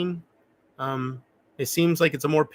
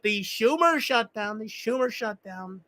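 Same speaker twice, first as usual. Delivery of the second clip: slow, higher impression voice; mock political voice; 5 semitones higher, 3 dB quieter than usual. Streamer mocks the shutdown in an impression voice. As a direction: lean on Schumer in a put-on voice. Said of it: "or as I call it".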